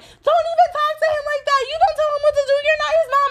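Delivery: very high mock child voice; bossy little kid. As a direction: imitate a mouthy kid scolding over the phone. Voices brother's bossy friend on phone.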